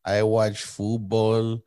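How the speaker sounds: very slow mock Mexican accent; jokey, badly faked Mexican delivery; fake Mexican accent. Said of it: "They'll know you're faking".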